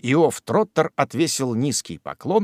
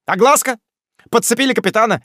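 high, jeering rapid-fire taunts; high jeering patter; mocking taunt; 9 semitones higher, 5 dB louder, much faster than usual. A narrator's reading. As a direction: needle them with glee. Jingle mocks the family.

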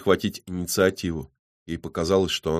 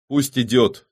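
firm, raised reply; 6 semitones higher, 5 dB louder, slower than usual. A narrator's reading. Авадонна answers the dwarf about the broadcast, firmly. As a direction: answer decisively, higher and louder. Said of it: "твёрдо ответила Авадонна".

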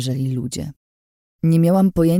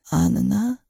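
tentative question, pitched up; uncertain voice; 7 semitones higher than usual. A narrator's reading. Prisoner recognising her friend, uncertain voice. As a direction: ask it hesitantly, rising at the end. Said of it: "niepewny głos".

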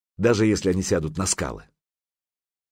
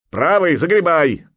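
high, loud command; urgent shouted order; 8 semitones higher, 7 dB louder than usual. A narrator's reading. Crew must row clear of the shoal, shouting. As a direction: bellow it to the rowers.

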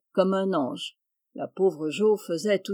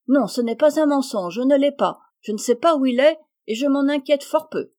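higher, louder defiant reply; defiant retort; 6 semitones higher, 5 dB louder than usual. A narrator's reading. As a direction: throw it back at her sister. Amy defiant in the quarrel. (réplique défiante, plus aiguë et forte)

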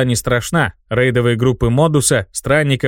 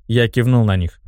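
ordinary delivery, nothing marked

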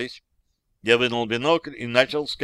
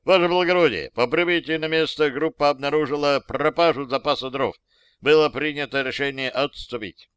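raised, higher official reporting voice; formal military report; 4 semitones higher, 3 dB louder than usual. A narrator's reading. Soldier reporting missing firewood to captain, formal military report. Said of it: "начал доклад".

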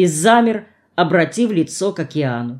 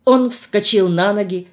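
ordinary delivery

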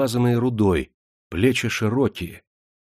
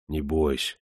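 ниже и тише, твёрдо; успокой её уверенно и негромко; 4 semitones lower, 5 dB quieter than usual. A narrator's reading. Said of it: "уверенно сказал Ивашка". (тихо и уверенно успокаивая девушку)

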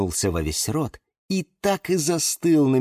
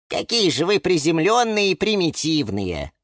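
higher-pitched, mildly sighing character voice; со вздохом; 7 semitones higher than usual. A narrator's reading. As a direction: sigh it out in mild exasperation. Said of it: "вздохнул Лимон Филимон". (с лёгким вздохом, повыше)

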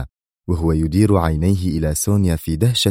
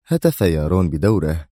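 usual speaking voice

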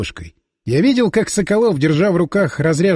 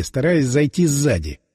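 ordinary delivery

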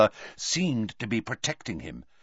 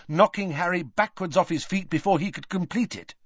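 plain reading voice

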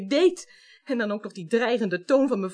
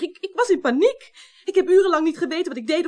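panicked; 5 semitones higher, 5 dB louder than usual. Acted. Interrogated, admits panic.

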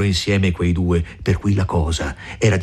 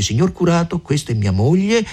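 the man's words; 10 semitones higher than usual. Retold or imitated. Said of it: "L'uomo dice".

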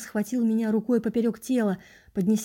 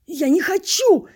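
loud, high strained protest; high, drawn-out cry of refusal; desperate rasped cry; 8 semitones higher, 8 dB louder, slower than usual. A narrator's reading. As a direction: shout it out in desperation. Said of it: "прохрипел я".

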